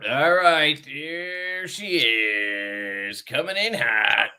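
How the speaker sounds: very stretched, slow words; playful, elongated build-up; drawn-out announcement. Pretend. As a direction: draw it out like a showy introduction.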